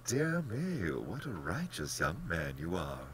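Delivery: lilting, genial reassurance; polished, pleasant general, lifting in pitch; most pleasant manner. A narrator's reading. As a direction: chide and reassure with airy pleasantness. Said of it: "said in his most pleasant manner".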